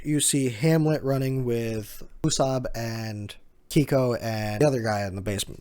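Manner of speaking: usual speaking voice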